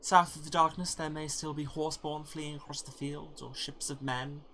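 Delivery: wistful hopeful hero, softening toward the end; hopeful character voice. Acted. Voicing a character.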